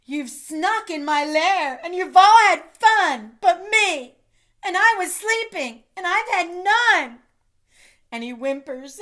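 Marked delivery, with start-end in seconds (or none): 0.1-7.2 loud, higher, wounded roar; a grumpy bear complaining he missed the fun; angry bear. Acted. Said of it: "He's angry".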